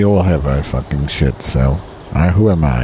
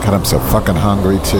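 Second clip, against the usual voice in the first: normal talking voice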